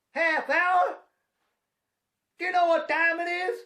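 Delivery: high, loud disbelief; hammed-up indignant cry; mock outrage. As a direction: blurt it out in shocked disbelief. Indignant retold outburst.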